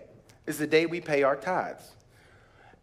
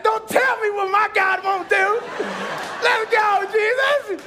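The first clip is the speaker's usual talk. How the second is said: high, loud joyful shout with laughter; fired up; 5 dB louder than usual. Retold or imitated. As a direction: shout it in triumph to your wife. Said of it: "I was fired up".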